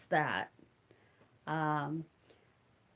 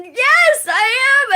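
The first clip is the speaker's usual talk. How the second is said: much higher and louder, thrilled reply; excited yes; 10 dB louder, slower than usual. Retold or imitated. Answering the poet who named her daughter Melissa, thrilled and loud. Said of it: "And I was so excited".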